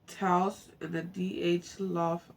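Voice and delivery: reading the book aloud, lightly paced; story reading. Acted. Viewer reading a travel memoir aloud, plain narration.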